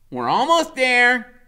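high, loud, slow; Freak's voice. A narrator's reading. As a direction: reassure the weary steed. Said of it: "he says".

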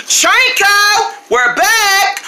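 high, sing-out arrival call. Acted. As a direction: call to a friend through the house. Calling out on arriving home.

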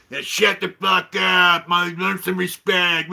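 mock tirade at noisy kids; imitated angry yelling. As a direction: mimic a cranky old neighbour yelling. Acting out grouchy neighbour yelling at kids.

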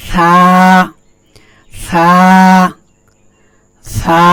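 সা said softly, with the tongue tip showing. Shown as a demonstration. Teacher showing students a soft সা. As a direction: gently model the letter সা. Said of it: "খুব নরমভাবে বলতে হবে".

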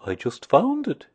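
a hint of simple innocence; Pooh. A narrator's reading. Pooh answers Christopher Robin.

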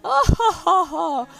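hearty agent laugh. Retold or imitated. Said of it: "finally he was like".

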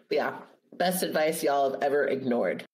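usual speaking voice

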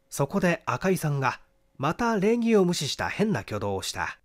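ordinary delivery

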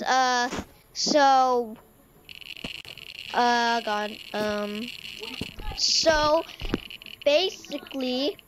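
normal talking voice

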